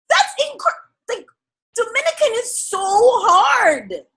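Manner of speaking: high, slow, awed gushing. Retold or imitated. Still gushing over the actor's Dominican voice. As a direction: draw it out, wowed. Retelling her amazement.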